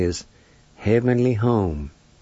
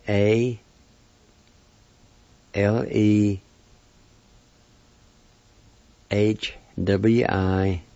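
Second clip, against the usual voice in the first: letters given one by one, long gaps; spelled out phonetically; much slower than usual. Shown as a demonstration. Teacher spelling the word for period, spelled out. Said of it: "it's spelled this phonetically".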